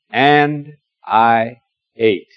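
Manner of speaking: very slow, each word weighed; slow emphatic confession; slow, deliberate. Acted. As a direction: lay the words down one by one. Stressing the shared final words.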